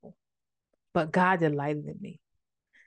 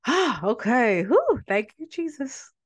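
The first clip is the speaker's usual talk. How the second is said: emotional praise; 6 semitones higher, slower than usual. Real, not acted. A preacher overcome with emotion thanking Jesus. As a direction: catch your breath and thank Jesus.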